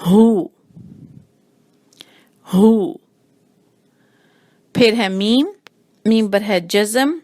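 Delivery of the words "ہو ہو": ہو louder with rounded lips; round huh. Shown as a demonstration. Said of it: "ہونٹوں کو تھوڑا سا گول بھی کریں گے". (ہو گول ہونٹوں سے)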